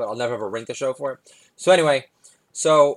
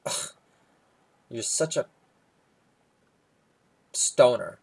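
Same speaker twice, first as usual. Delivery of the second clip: ordinary talk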